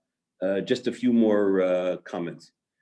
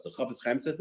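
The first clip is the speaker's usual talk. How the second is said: ordinary talk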